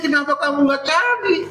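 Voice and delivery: usual speaking voice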